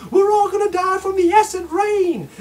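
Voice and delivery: high, loud panicky shout; hammed-up doom shout; mock panic. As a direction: wail it like the sky is falling. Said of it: "it was".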